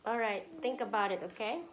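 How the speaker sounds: ordinary delivery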